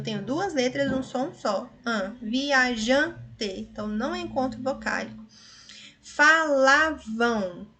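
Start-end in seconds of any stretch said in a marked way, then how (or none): 6.0-7.7 Falavam drawn out slowly, syllable by syllable; slow syllable demo. Shown as a demonstration. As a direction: stretch each syllable of Falavam. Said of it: "agora nesse finalzinho aqui eu tenho um ditongo".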